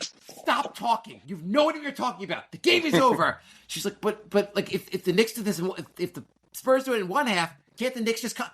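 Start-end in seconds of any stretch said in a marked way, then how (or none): 0.0-3.4 high-pitched retold outburst, loud at the end; exasperated snapping. Retold quarrel with wife. 4.0-8.5 higher, halting mock plea; imitated pleading wife. Imitating wife's hopeful pleading.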